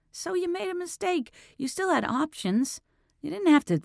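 high pleading, voice climbing; pleading rise. Acted. Detective pleads with Bobby on the phone, high and pleading. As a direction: plead with him, pitch rising sharply.

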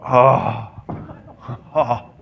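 big fake sigh of exasperation; put-on weary groan; exasperated groan. Shown as a demonstration. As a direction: exhale a mock-despairing "oh". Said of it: "I also like to do that one".

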